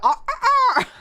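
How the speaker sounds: high loud laughing rooster crow. Retold or imitated. Rooster crow as alarm clock.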